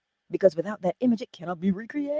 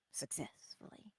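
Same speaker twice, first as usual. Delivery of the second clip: whispered echo; 5 semitones lower, 21 dB quieter than usual. Shown as a demonstration. Whispered echo of key word.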